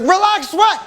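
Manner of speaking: high, incredulous snap; indignant retort. Retold or imitated. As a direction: demand an answer from the wife. Indignant reply to wife.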